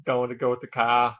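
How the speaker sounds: Boston speech with the R dropped in "car"; Boston accent. Shown as a demonstration. Vlogger showing one Boston dialect, Boston accent. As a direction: put on an everyday Boston accent. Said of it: "there's the one I just did".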